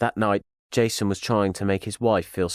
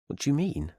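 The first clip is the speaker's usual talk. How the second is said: higher, taken-aback question; puzzled question; 7 semitones higher, 4 dB quieter than usual. A narrator's reading. Husband reacting to his wife's fear, taken aback. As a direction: ask it higher, not understanding. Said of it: "he asked".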